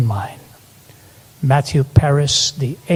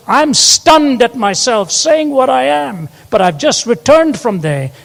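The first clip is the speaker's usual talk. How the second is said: preaching emphasis; 9 semitones higher, 4 dB louder than usual. Acted. Preacher quoting writer.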